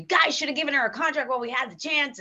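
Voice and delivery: imitating a mildly annoyed co-star, quick and high; a quick, exasperated gripe about the other show; annoyed complaint. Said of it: "And he was pissed".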